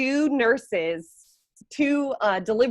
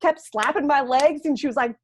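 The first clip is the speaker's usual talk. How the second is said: ordinary talk, nothing marked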